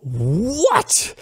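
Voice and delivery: long "What?" swooping up high; incredulous outrage. Acted. Questioning PayPal's decision a second time, incredulous outrage. Repeats his reaction to the denial.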